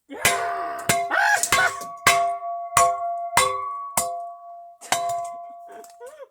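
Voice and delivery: very high, airy shriek of laughter. Real, not acted. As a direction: cackle wildly at the joke. After the villain line.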